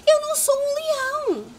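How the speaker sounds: high, loud pleading shout; desperate shout. A narrator's reading. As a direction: shout after him in distress. (grito desesperado e agudo)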